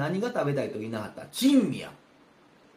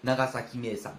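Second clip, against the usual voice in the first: ordinary talk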